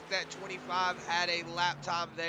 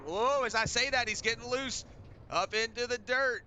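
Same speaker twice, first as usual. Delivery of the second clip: sudden high-pitched commentator excitement; excited race call; 7 semitones higher, 3 dB louder than usual. Real, not acted. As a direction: voice jumps up high with alarm. Live call of car getting loose.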